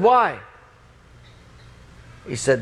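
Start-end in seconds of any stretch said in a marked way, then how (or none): 0.0-0.4 irritated retort. Acted. Irritated reply to roommate.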